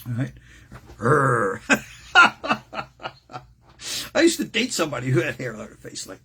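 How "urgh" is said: louder mock werewolf growl. Mimics a movie werewolf.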